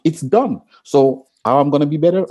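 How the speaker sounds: normal talking voice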